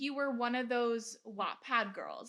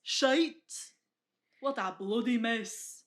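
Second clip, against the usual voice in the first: put-on loud, slow Scottish accent; hammed-up Scottish accent; 7 dB louder, slower than usual. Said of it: "Can you imagine if they were Scottish?".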